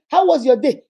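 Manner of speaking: an edgy spouse hitting the greeting hard; sharp demanding question. Acted. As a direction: bark the question at him. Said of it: "did I say".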